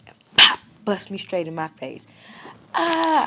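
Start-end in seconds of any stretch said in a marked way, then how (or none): 2.7-3.3 sharp high cry of pain, retold; a quick pained scream. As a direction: yelp as if just hit. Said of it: "I screamed".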